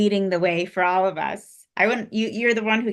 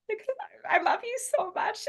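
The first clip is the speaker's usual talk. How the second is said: choked up, fighting back tears; real tears breaking through the words; crying; 5 dB quieter, faster than usual. Real, not acted. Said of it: "I'm sorry, I'm crying".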